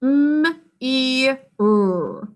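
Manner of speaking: "M, E, R" as mmm, ee, rrr; "M, E, R" drawn apart, one sound each; separate letter sounds. Shown as a demonstration. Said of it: "What are the sounds in this word?".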